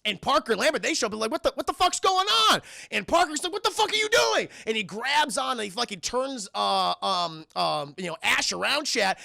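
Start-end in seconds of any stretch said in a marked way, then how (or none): 1.3-2.6 high, loud, alarmed shout; alarmed mock yell. Acting Parker and Lambert showing up, alarmed yell. Retold crewmates arriving alarmed. 3.5-4.5 very high, raised-voice outrage; mock outraged yell. Acting Parker confronting Ash, mock outraged yell.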